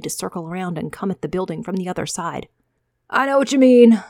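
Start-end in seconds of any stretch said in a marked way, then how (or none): 3.1-4.0 much higher, louder henchman voice; a keen thug chiming in; eager henchman. Acted. Cohort agreeing with Micah, high and loud.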